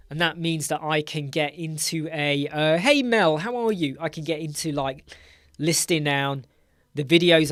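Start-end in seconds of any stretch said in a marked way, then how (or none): 2.8-4.0 high, loud cheerful hello; bright greeting. Real, not acted. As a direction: greet her with a lift.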